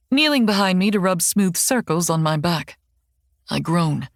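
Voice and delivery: ordinary delivery, nothing marked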